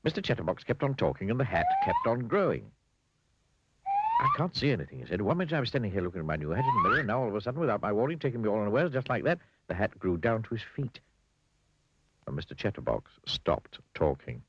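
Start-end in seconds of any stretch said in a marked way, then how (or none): 3.8-5.0 high startled cry from under the hat; sudden alarm, lost inside the hat; muffled panic. Acted. Chatterbox trapped under his growing hat, startled. 5.2-11.0 fast, tumbling babble; rushed chatter. Acted. Chatterbox explaining the growing hat, rushed babble.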